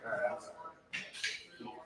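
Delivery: slow, hushed apology while scooping up the dog; sheepish whisper to the people nearby. Retold or imitated.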